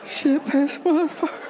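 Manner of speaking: put-on high squeaky kid voice swearing; toddler cursing. Comedian mimics his little kid swearing in a high voice. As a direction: squeak out the curses like a tiny angry toddler.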